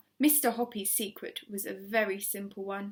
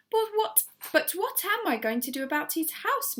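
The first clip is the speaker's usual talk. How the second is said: worried Missus Silver; 7 semitones higher than usual. A narrator's reading.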